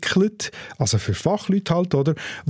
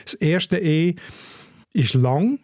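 usual speaking voice

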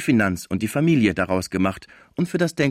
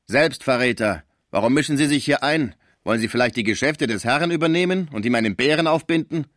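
loud, higher indignant outburst; angry retort; 5 semitones higher, 4 dB louder than usual. Acted. The Sauvage snapping at Topinard, loud and high. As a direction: bite back at the meddler.

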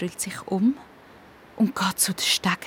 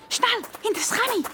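much higher and fast, hushed urgency; urgent hurry; 13 semitones higher, faster than usual. Acted. Child urges friend to hide behind the chimney. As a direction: rush the order, almost squeaking.

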